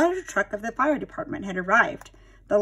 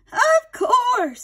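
glad aunt; 7 dB louder than usual. Acted. Aunt Sarah agrees.

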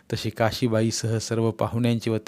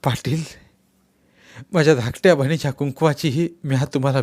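louder, higher earnest entreaty; humble begging; 5 semitones higher, 5 dB louder than usual. Acted. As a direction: beg him on your knees. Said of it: "पायावर ठेवीत बोलले".

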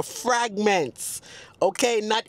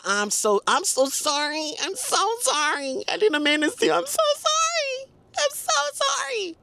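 exaggerated apology; 12 semitones higher than usual. Shown as a demonstration. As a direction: squeak out the apology in a falsetto. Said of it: "you end it with a".

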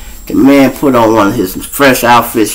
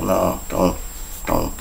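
much softer, lower warning; hushed warning; 4 semitones lower, 12 dB quieter, slower than usual. Retold or imitated. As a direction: hold him back quietly. Partner restraining cop.

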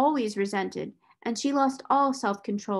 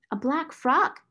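flustered; 6 semitones higher, 3 dB louder, slower than usual. Acted. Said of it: "Miss Amelia s-, stammered again".

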